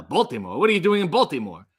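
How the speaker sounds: "Baltimore" said as "Bol-timore", fast and punchy; Bud Abbott impression. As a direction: bark "Baltimore" like a vaudeville straight man. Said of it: "Bud Abbott says it in Abbott and Costello".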